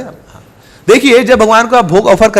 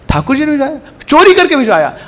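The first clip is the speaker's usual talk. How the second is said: astonished; 7 semitones higher than usual. Acted. Ascetic astonished at the gift.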